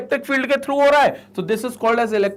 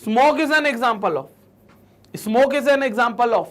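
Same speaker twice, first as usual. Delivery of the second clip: slow dictation; much slower than usual. Shown as a demonstration. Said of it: "reads exam question aloud".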